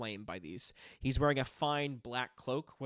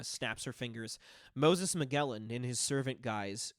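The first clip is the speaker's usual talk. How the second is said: ordinary delivery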